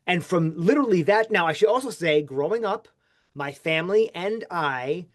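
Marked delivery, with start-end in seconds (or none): none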